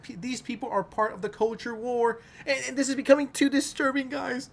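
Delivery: high, whiny mock of a Redditor; mock a pearl-clutching online loser. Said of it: "they're out here being like".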